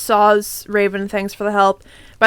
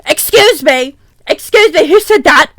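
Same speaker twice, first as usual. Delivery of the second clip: loud, higher outraged call; indignant yell; 3 semitones higher, 7 dB louder than usual. Acted. Janitor yelling at the unseen voice under the cloak. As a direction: demand to know, loudly. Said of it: "yelled Mister Norris".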